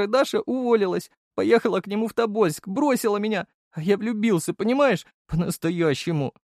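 high-pitched tearful lament; jilted young man wailing; heartbroken whining. A narrator's reading. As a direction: pour out the grievance in a thin, weepy voice. Crying deputy tells of being dumped.

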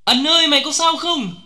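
very high, loud frantic yell; shouting till the voice nearly cracks; desperate screaming. A narrator's reading. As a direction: scream out for your fallen comrades. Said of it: "Tôi gào lạc cả giọng".

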